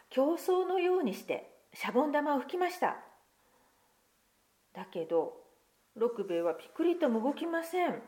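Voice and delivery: put-on lively picture-book reading to children; story narration. Pastor reading a picture book to children, lively storytelling. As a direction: read the tale aloud to the congregation, expressive.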